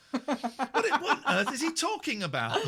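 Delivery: high laughing exclamation; high-pitched amused incredulity; laughing disbelief. Real, not acted. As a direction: crack up in bafflement. Laughing at caller's insult.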